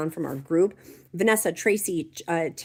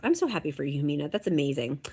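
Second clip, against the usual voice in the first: ordinary talk, nothing marked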